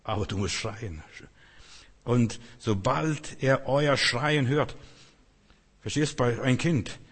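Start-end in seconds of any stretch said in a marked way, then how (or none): 2.1-4.8 drawn-out emphatic reading. Acted. Preacher stresses the verse.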